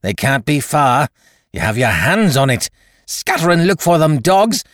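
loud, high, building tirade; crying out in rage. A narrator's reading. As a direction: blind pirate chief's frantic outburst. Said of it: "he cried".

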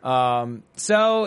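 usual speaking voice

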